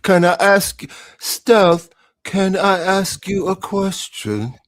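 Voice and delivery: high, loud, slow mock-formal plea; mock formal ask. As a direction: draw it out in a put-on voice.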